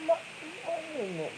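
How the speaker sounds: high, slow exasperated drawl; giving up, high and slow. Real, not acted. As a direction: sigh it out.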